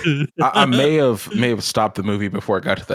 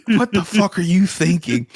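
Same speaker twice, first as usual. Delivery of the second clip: high, incredulous outburst; mock outrage; 10 semitones higher, 4 dB louder than usual. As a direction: exclaim it in laughing disbelief.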